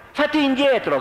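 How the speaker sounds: high, loud yelled order; angry shout. A narrator's reading. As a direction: shout him back hard. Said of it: "gridò Alex".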